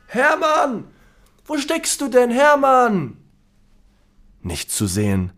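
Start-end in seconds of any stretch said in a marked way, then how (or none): 0.1-3.2 high calling voice. Acted. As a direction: call his name across the room. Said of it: "rufen seinen Namen". (laut nach Hermann rufen)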